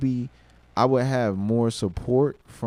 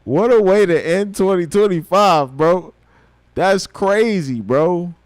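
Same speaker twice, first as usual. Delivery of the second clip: louder, higher, slowed amazement; thrilled; 5 semitones higher, 7 dB louder than usual. Real, not acted. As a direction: savour the disbelief out loud. Celebrating the feature.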